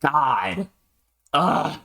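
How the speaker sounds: real exasperated groan; frustrated. Real, not acted. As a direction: groan in frustration at your own acting. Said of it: "getting frustrated".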